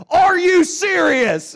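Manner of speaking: high, drawn-out incredulous shout; top-of-lungs yell. Retold or imitated. Coworker confronting the man without a wallet in the shop, yelling. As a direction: bellow it slowly at full volume, imitating outrage. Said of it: "at the top of her lungs".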